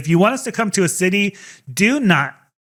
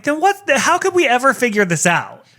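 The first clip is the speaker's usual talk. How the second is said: exasperated disbelief; 7 semitones higher than usual. Retold or imitated. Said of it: "I'm like".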